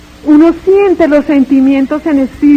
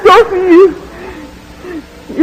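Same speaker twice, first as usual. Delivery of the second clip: much higher, sobbing insistence; crying shout; 7 semitones higher, much slower than usual. Retold or imitated. Woman insisting she has loved God, high tearful shouting. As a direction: cry out yes through tears, desperate to convince. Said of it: "Yo grité".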